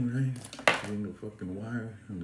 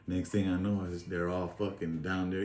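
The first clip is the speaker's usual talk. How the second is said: ordinary delivery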